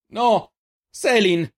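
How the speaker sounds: high, loud cry of protest; desperate shriek. A narrator's reading. Reynal held back by a guard, desperate shriek. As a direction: cry out for someone being taken. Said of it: "strillò Reynal".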